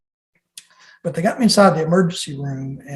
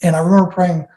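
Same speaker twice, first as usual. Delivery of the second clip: ordinary talk, nothing marked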